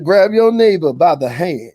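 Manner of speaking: hollering revival preacher. Pretend. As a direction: command the congregation, preacher style. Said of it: "I said".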